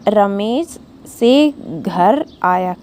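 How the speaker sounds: slow, leaving a pause at "से"; slow fill-in-the-blank read; gap for the blank. Shown as a demonstration. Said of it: "तो".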